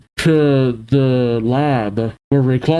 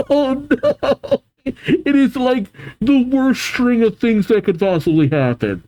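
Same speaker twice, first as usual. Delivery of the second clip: high-pitched, laughing through the words; laughing dismay; 10 semitones higher than usual. Real, not acted. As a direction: react in amused disbelief, voice way up. Reader cracks up at disaster.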